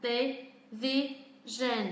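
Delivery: Television syllable by syllable, all evenly weighted; very slow, separated syllables in "Television", none stressed; no word stress. Shown as a demonstration. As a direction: sound out Television slowly and evenly. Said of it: "hoàn toàn không có trọng âm".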